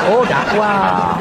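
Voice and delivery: long, slow drawn-out sarcastic oh before dakwah; mock realisation. To viewers, sarcastically drawling the word "dakwah" slowly. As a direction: stretch the word "dakwah" out slowly in fake discovery. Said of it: "Dakwah namanya".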